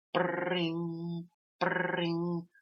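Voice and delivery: high sung ring ring; phone ring imitation.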